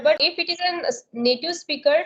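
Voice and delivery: ordinary delivery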